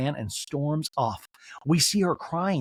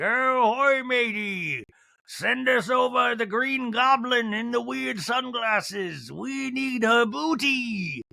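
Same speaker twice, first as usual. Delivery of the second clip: slow, drawn-out pirate call, higher; 8 semitones higher, much slower than usual. Pretend. Recapper playing a pirate hailing the cast, slow hammed-up pirate. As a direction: ham it up like a pirate captain hailing a ship. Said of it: "This is where I wish we did have pirates".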